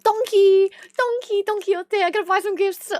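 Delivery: high, singsong mock excitement; hammed-up high-pitched eagerness; giddy mimicry. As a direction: play up an overexcited shopper's one-track mind. Mimics own giddy shopping urge.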